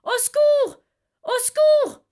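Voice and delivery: very high, loud terrified screams; a woman's shrill, loud cries for help; screaming for help. Acted. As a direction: shriek for help in panic. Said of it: "criait effroyablement".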